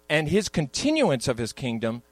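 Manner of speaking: usual speaking voice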